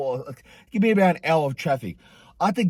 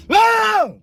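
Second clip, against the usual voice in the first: loud, very high re-enacted scream; long high-pitched yell; pained scream; 6 dB louder than usual. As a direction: scream out in acted pain. Screaming at painful treatment.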